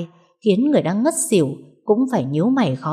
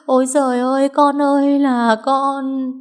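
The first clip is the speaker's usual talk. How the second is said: high, loud, drawn-out wail for her son; wailing lament; 8 semitones higher, 4 dB louder than usual. Acted. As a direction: wail it slowly like a grieving mother. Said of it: "rền rĩ khóc than". (than khóc rền rĩ, cao và to)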